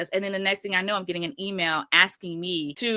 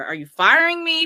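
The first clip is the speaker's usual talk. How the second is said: higher, louder confrontational question; raised pitch, pointedly demanding an answer; retold challenge; 10 semitones higher, 7 dB louder than usual. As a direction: replay an incredulous challenge to the boss. Retold confrontation at resignation meeting.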